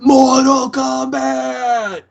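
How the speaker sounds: high, slow, stretched-out yell of MORTAL KOMBAT; booming announcer shout. Pretend. Co-host yells the game title like an arcade announcer, slow and high.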